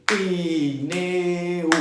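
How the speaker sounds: chanted "Pi, ne, u", each beat held long on a level note; mock schoolroom chant of "Pi, ne, u"; sing-song "Piii, neee, uuu" as if clapping along; clapping-out syllables. Shown as a demonstration. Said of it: "aprendeu a separar batendo palminhas".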